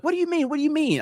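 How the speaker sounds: high-pitched mock questioning; insistent mom. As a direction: imitate mom pressing for an answer. Acting out mom demanding an explanation.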